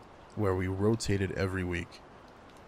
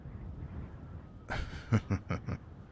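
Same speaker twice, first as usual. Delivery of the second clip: quiet disbelieving laugh under the breath; a hushed chuckle of surprise; soft amused laugh; 7 dB quieter than usual. Real, not acted. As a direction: laugh softly at the odd request. Amused at listener's silence.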